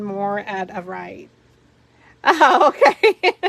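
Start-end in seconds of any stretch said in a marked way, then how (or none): 2.2-3.3 slow, loud, laughing "Oh, okay."; amused, drawn-out okay; laughing reply. Real, not acted. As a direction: give in while still laughing.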